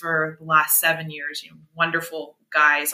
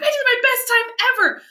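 much higher, proud shout of news; excited brag. Retold or imitated. Runner telling husband about best time, excited and much higher. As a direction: burst in bragging, voice way up. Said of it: "I come home and I'm like".

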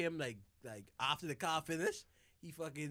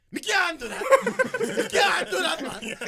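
loud, higher mock Jamaican shout; aggressive Jamaican accent; 12 semitones higher, 14 dB louder than usual. Jamaican refusing a simple painting request, shouted in aggressive accent. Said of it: "sound like they trying to fight you".

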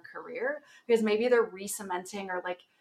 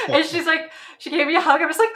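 higher and louder, words through laughter; laughing speech; 8 semitones higher, 12 dB louder than usual. Real, not acted. Telling the host about the hug, laughing through words. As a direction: talk while still laughing.

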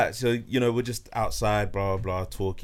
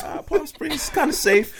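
high, slow carefree voice; naive relaxed self; 6 dB louder, slower than usual. Retold or imitated. Storyteller mocking his own naive thought, high and slow. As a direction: play your past self as easy and careless. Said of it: "I was like".

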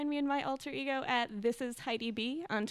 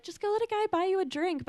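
nagging mom; 7 semitones higher than usual. Retold or imitated. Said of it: "my mom will always nag me".

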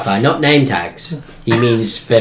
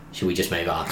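mumbled quote; 8 semitones lower, 14 dB quieter than usual. Retold or imitated. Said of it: "a bunch of people just going".

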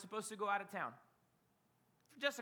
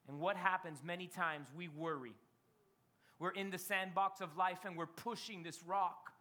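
usual speaking voice

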